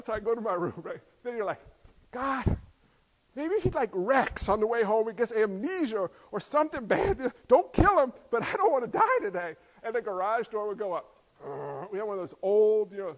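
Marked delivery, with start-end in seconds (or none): 2.1-9.6 louder, desperate plea; child praying dad crashes on the way home; panicked kid prayer. Retold or imitated. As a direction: pray fast and scared.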